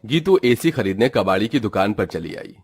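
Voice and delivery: usual speaking voice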